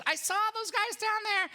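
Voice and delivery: put-on very high, squeaky kid's call; high-pitched mock child's yell; tattling boy. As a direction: pipe up like an excited little boy telling on someone. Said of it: "that's what it sounds like".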